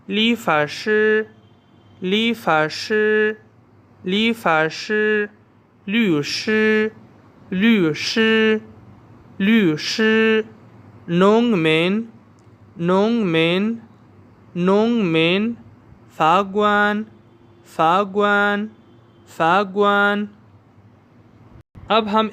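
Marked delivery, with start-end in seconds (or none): 0.1-10.4 लीफाशी and लूशी said slowly and stretched out; slow repeat after me. Shown as a demonstration. Teacher drilling students, slow and higher. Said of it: "आप सब मेरे साथ पढ़ें". 11.1-20.3 नोंगमेन rising, फागवान said clearly; repeat after me. Shown as a demonstration. Teacher drilling students, clear. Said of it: "आप सब मेरे साथ पढ़ें".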